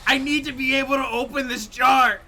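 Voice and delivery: high, loud mock repeat of her complaint; mocking imitation. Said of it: "repeats S0 line".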